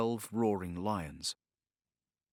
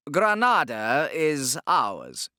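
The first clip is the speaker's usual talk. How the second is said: slow, higher, louder Inquisitor, calmly final; matter-of-fact; 6 semitones higher, 7 dB louder, much slower than usual. Acted. Torquemada claiming Granada to the Sultan, matter-of-fact. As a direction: state conquest as plain fact. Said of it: "he said, matter-of-factly".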